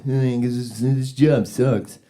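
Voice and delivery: mock sullen mumble, dragging it out; attitude. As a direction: grumble it like a kid who hates the job. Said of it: "He's holding with attitude".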